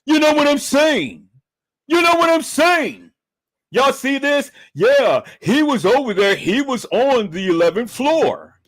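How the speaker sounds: mocking high-pitched imitation of a rival; loud, high mock excuse-making. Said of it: "he tried to put a spin up on it".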